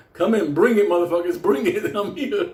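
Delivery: egging on an opponent, half laughing; taunting dog's voice. Retold or imitated. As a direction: goad them on like a cocky guard dog. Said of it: "He was actually standing ... thinking".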